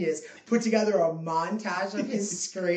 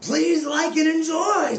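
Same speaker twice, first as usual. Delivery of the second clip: hyped-up plea; 9 semitones higher, 7 dB louder, slower than usual. Acted. Mock announcer sign-off.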